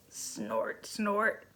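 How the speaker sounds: quiet low snorts of a sleeping hamster; soft snoring. A narrator's reading.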